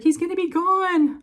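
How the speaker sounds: mock tearful daughter upset the bunny will be gone; crying child. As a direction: wail it like a tearful little girl. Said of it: "she was crying".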